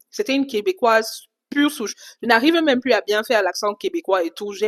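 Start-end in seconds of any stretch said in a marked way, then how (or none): none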